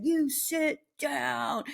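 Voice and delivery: measured, commanding pack-leader voice; slow pack leader command. Retold or imitated. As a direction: spell it out slowly so the dog obeys. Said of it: "pack leader".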